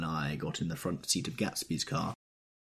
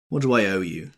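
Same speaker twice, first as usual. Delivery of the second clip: louder, harsh demand; 8 dB louder than usual. A narrator's reading. Tom harshly demanding the bill from Wilson. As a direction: cut him off coldly. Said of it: "demanded Tom, harshly".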